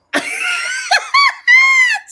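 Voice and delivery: high, loud cackle; shrieking laugh. Real, not acted. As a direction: burst into piercing, uncontrolled laughter. Breaks up at retold story.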